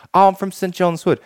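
high, put-on London English accent. London friend making up where he's from, imitated London accent. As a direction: imitate a Londoner, pitched well up. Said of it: "he makes something up".

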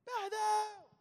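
high shouted gloat; bully's mocking yell; taunting shout. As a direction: yell it in his face. Bully hitting his chest.

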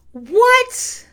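a high, loud "What?"; shocked disbelief. Real, not acted. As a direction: yelp "What?" in outraged disbelief. Reacting to Karen refusing to look.

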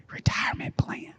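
breathy seductive whisper; mock seductive whisper; sultry whisper. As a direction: whisper it like a come-on.

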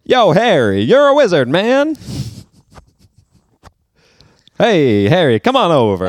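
loud, high hollered greeting in character; character impression. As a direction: call out heartily in a put-on voice. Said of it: "I've been working on it".

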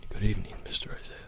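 hushed, quick butler greeting. Shown as a demonstration. Host having another go at the butler, whispered and quick. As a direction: whisper it briskly like a discreet butler. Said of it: "That was kind of like".

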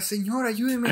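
high, pleading call for help; cry for help. Retold or imitated. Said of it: "Y empieza a gritar".

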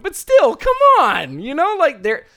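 high, loud exasperation; exasperated. Real, not acted. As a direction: plead with them to see it. Exasperated plea.

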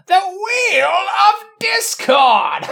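high, slow booming announcer voice; game show announcer. Pretend. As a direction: stretch it out like a game show host. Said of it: "You're doing the voice".

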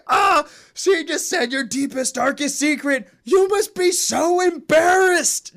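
high, loud voice pushed through laughter; mock laughing Drax. Drax tells Quill his deepest secret, laughing hard. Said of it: "he's just dying laughing".

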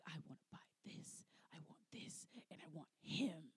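very soft, low imitation of a wanting woman; mock intense whisper. Host imitating the woman who wants the dad, mock whisper. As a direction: whisper the demands as her, low.

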